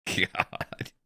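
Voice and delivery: normal talking voice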